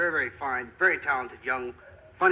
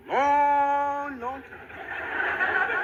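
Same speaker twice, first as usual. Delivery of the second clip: long stretched-out words, higher; comically elongated, pitched up; drawn out for emphasis; 8 semitones higher, much slower than usual. Acted. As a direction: drag it out to rub it in. Spells out how late, comically.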